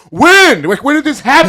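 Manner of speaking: high, loud burst of questions; mock astonished demand. Imitating husband quizzing wife about her ex, astonished shouting. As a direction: punch out the first word in disbelief.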